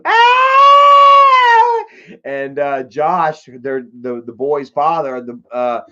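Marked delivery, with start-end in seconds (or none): 0.0-1.8 high, loud imitated shriek; a sudden piercing scream, acting it out; retold scream. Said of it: "I heard Jennifer scream".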